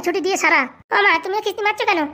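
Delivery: usual speaking voice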